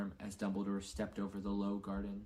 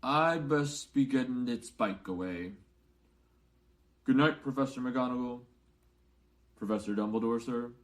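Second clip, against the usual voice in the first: muffled voice; 5 dB louder than usual. A narrator's reading. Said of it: "in a very muffled voice".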